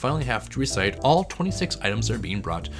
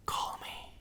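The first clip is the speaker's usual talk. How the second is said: whispering; 12 dB quieter than usual. Acted. Joking aside after sponsor mention.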